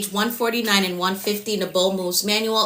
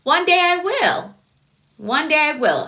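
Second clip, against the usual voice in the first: much higher, sing-song "One day I will"; playful high promise; 7 semitones higher, 3 dB louder than usual. Shown as a demonstration. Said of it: "You're laughing at me".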